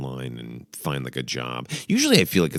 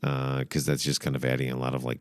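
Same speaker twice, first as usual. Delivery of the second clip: ordinary talk, nothing marked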